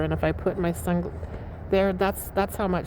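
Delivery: ordinary talk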